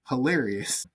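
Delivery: ordinary delivery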